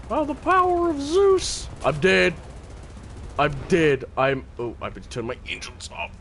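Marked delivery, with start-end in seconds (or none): 0.1-1.7 high, slow hero's battle cry; hammed-up, high grandiose cry; mock-epic invocation. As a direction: call on the gods in a high silly voice.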